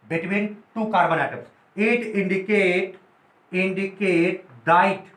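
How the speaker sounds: word by word, very slow, pausing for notes; slow dictation. Shown as a demonstration. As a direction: dictate so students can write it down. Teacher dictating notes slowly. (धीमे-धीमे लिखवाते हुए)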